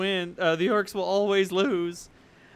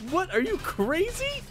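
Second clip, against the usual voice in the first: outraged demons; 8 semitones higher, 4 dB louder than usual. Retold or imitated. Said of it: "demons in there who were like".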